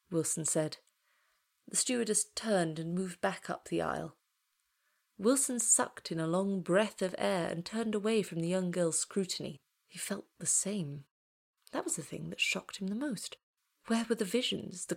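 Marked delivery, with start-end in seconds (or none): none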